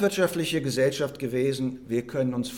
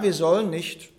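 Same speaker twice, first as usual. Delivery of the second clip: ordinary delivery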